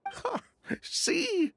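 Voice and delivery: smug mockery. Mocking after poison test clears.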